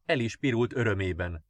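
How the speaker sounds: ordinary delivery, nothing marked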